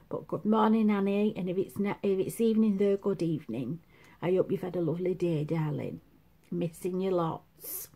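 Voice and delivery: soft, quiet fond greeting; tender message. Real, not acted. To cousin Annie far away, soft and fond. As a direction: speak gently to a dear cousin.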